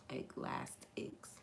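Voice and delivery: Eclastics whispered slowly under the breath. Shown as a demonstration. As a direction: breathe Eclastics, unvoiced and drawn out. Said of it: "She said Eclastics".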